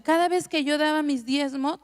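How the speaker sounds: ordinary delivery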